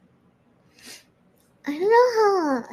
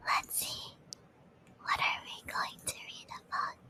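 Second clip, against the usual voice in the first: ASMR whisper; 5 semitones lower, 5 dB quieter than usual. Acted. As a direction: whisper close to the mic.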